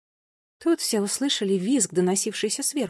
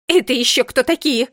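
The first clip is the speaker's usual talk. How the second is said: high, loud shrieked question; 4 semitones higher, 9 dB louder than usual. A narrator's reading. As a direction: squeal it out in outrage. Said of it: "взвизгнула она". (громкий возмущённый визг)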